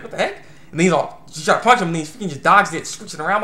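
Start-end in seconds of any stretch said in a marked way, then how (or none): 0.0-0.3 much higher, baffled what the heck; mock surprise. Fighter baffled when her kick gets blocked, high squeak. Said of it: "It's like, it's like".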